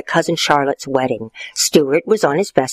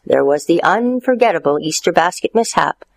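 ordinary delivery, nothing marked

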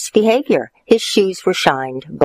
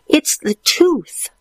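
put-on boy voice; 12 semitones higher, slower than usual. Stuart high.

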